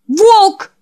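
very high, loud cry of the name; shout. Acted. Jack crying out as Wolf disappears, very high loud shout. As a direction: scream after him as he vanishes.

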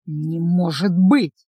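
slow, low hiss of disbelief; a vampire hissing in disbelief, drawn out slow. A narrator's reading. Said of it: "прошипел вампир".

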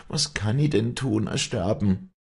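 high, anguished pleading; Wally confessing her despair to the priest. A narrator's reading. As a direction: pour out her guilt.

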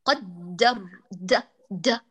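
«قدر د» dragged slowly with the د split off; «قدر د» drawn out, the second د detached; slow broken qadr. Shown as a demonstration. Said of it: "فيخرج هكذا".

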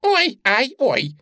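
high bunny ow-ow-ow; high squeal dropping off; squealed yelps. A narrator's reading. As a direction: yelp in pain as the rabbit. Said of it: "взвизгнула она за кролика".

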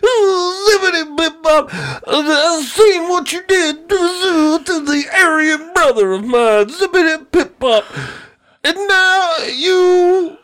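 high, slow menacing Cosby voice; Bill Cosby impression. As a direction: threaten like a creepy Cosby. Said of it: "He's like".

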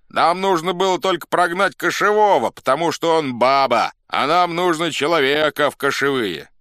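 high, loud, forceful calling; crowd shouting. A narrator's reading. Crowd explains to officers whom they want, shouting. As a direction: shout down the old chief. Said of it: "закричали из толпы".

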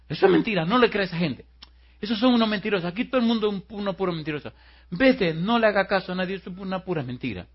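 high, loud, agitated retold outburst; nervous, tearful protest. Tearful man tells the girl to ignore liars, agitated. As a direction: push her away with frantic denials. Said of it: "se comenzó a poner nervioso y se le llenaron los ojos de lágrimas".

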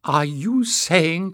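slow, high, loud challenge; incredulous cry. A narrator's reading. Tyrrell challenging the companions, incredulous cry. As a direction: cry it out in drawn-out disbelief. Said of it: "cried Tyrrell".

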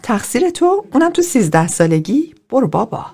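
leaps high in disbelief; incredulous. Acted. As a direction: scoff at the idea, pitch jumping. Bonnie disbelieving Gil's blame.

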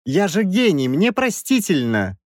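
high-pitched, self-important excuse; stubborn. A narrator's reading. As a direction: insist on your privilege in a high voice. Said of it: "продолжал упорствовать Краевский".

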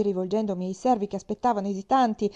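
plain reading voice

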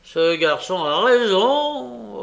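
slow, high, loud gloating; sly villain. A narrator's reading. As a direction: draw it out with sly relish.

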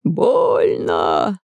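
low shout of pain; pained cry. A narrator's reading. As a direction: cry out that it hurts. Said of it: "закричал купец".

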